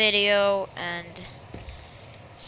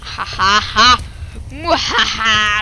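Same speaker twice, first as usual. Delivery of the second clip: sneering panto villain; 6 dB louder, slower than usual. Acted. Gloating evil laugh after plan.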